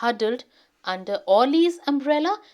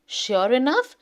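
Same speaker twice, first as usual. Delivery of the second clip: ordinary delivery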